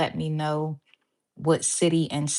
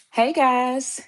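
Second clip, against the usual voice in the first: slightly winded greeting; breathless after the stairs; out of breath; 7 semitones higher, 4 dB louder than usual. Real, not acted. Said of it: "I'm out of breath".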